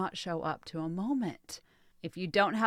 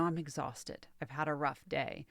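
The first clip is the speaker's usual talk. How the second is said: worn-out voice, much softer; tired partner; 5 semitones lower, 9 dB quieter than usual. Retold or imitated. Partner explains the rough day, quietly and tired. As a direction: let the words sag with exhaustion. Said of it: "telling you where they are physically".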